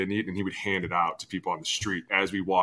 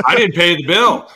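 indignant protest; 8 semitones higher, 10 dB louder than usual. Retold or imitated. Protesting on phone.

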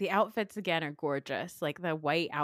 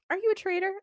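mock question; 12 semitones higher, 5 dB louder, much faster than usual. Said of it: "She was like".

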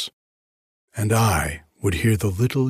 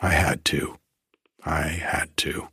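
whispering; 4 semitones lower, 3 dB quieter than usual. Acted. Stephen's anguished confession.

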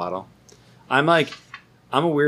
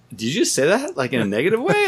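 incredulous; 7 semitones higher than usual. Retold or imitated. Mock outrage at the reviewer.

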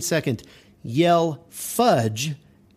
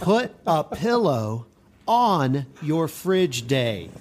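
slowly, through a laugh; slow, laughing emphasis; slower than usual. Shown as a demonstration. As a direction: spell it out slowly, amused. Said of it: "let me say that again".

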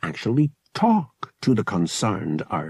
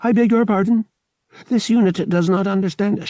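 high, louder mechanical repetition; uncomprehending droid; 11 semitones higher, 6 dB louder, faster than usual. A narrator's reading. Droid answers an insult, insistent and mechanical. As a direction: recite it insistently, like a stuck robot.

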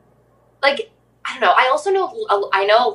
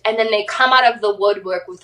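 normal talking voice

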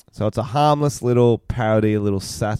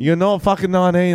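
loud disbelief; 9 semitones higher, 5 dB louder than usual. Pretend. Bantering back at the role.

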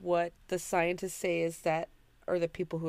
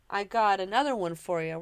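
normal talking voice

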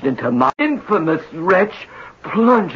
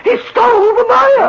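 higher, louder melodramatic wail; anguished wail; 3 dB louder than usual. Acted. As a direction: howl the loss in despair. Designer rants about theft.

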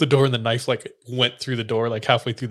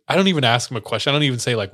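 ordinary talk

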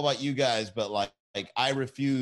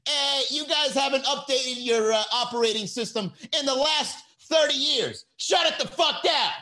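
mock shout; 10 semitones higher than usual. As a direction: bark it like an angry bar fixer.

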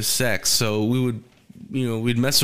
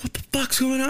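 retold angry dad; 13 semitones higher than usual. Man pounding on the door confronts the couple, mock outrage. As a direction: imitate an indignant dad, pitch way up.

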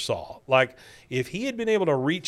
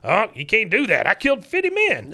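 much higher, louder codger; mock outraged veteran; 9 semitones higher, 5 dB louder than usual. Cotton refusing the boss, outraged codger voice. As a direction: protest like an indignant old soldier.

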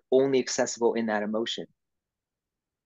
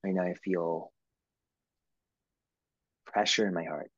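soft guided voice; 4 semitones lower, 5 dB quieter than usual. Shown as a demonstration. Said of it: "where do you feel things".

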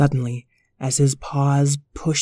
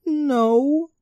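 puppy voices; 6 semitones higher than usual. Acted. Puppies chat about flying.

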